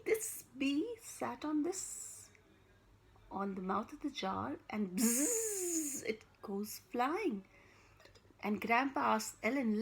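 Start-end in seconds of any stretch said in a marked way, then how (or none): 4.7-6.0 a playful buzzing bee sound. Acted. Storyteller buzzing the bee for the children. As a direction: buzz like a little bee.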